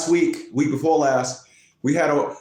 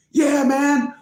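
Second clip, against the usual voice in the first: imitated client; 9 semitones higher than usual. Retold client's reply.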